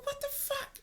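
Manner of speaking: very high, thin squeal, kept soft; mock freak-out scream. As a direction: imitate friends freaking out in a stifled shriek. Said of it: "screaming and freaking out".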